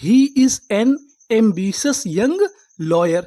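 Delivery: put-on "He is an ambitious young lawyer" read very slowly in a careful reading voice; slow example sentence. Shown as a demonstration. Teacher reading an example sentence to students, very slow and clear. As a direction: draw each word out slowly for the class. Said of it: "इसका sentence देख लेते हैं".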